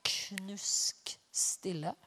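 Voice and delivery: hushed whisper. Acted. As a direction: whisper it, barely a breath. Room falls silent.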